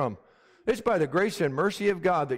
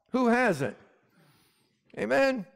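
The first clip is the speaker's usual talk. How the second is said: ordinary talk, nothing marked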